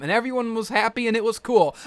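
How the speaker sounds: ordinary talk, nothing marked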